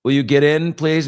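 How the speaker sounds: mock-urgent plea through the car window; loud retold pleading.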